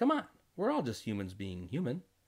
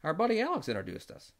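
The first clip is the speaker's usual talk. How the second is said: high voice; 11 semitones higher than usual. Retold or imitated. The husband answers the newcomer in a raised high voice. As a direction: voice pitched well up, light and quick. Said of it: "And I said".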